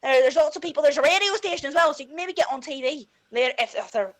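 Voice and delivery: put-on thick Belfast accent on request. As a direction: tell it like a Belfast lad. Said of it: "do your Belfast accent".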